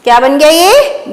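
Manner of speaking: ordinary delivery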